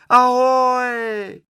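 very high, loud, long-held ahoy; full-voiced high halloo. A narrator's reading. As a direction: belt out a long sailor's call. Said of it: "toen luider en luider".

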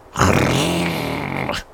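soft playful growl. Acted. Stampertje showing Bambi how to growl fiercely. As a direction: growl like a little beast. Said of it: "en gromt".

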